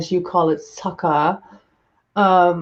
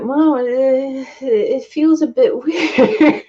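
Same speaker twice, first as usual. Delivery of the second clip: put-on sheepish kid; 6 semitones higher, much slower than usual. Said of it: "I was like".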